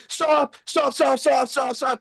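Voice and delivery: high, rapid-fire pleading; mock panic. Border staff halting the phone call, high panicked plea. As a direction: imitate frantic officials begging for a halt. Officials' frantic plea, acted.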